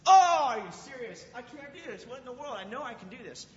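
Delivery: high, loud burst of disbelief then dropping; exasperated disbelief. Retold or imitated. Youth pastor retelling his failed breath-hold, exasperated. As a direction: blurt out retold frustration at the poor time.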